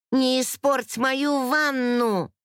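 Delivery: high, drawn-out exclamation; high indignant call, stretched out; crying out. A narrator's reading. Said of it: "выкрикнула я".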